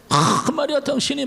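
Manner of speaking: high-pitched mock confrontation; imitated argumentative prayer. Seminary student praying to God as if disputing, mock-argumentative. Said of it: "따지듯이".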